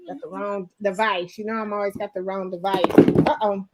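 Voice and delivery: ordinary talk, nothing marked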